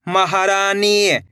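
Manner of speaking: slowly joined syllables; slow blend. Shown as a demonstration. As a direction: draw the word out syllable by syllable. (धीरे धीरे जोड़कर)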